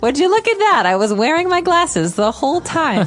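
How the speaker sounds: mom's surprise. Retold or imitated. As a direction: squeal it like a surprised mom. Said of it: "That's my mom".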